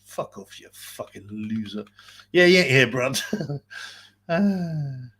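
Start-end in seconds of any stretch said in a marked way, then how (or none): 0.0-1.8 soft, breathy brush-off; quiet scornful aside to an absent troll; muttered dismissal. Real, not acted. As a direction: toss it away under your breath.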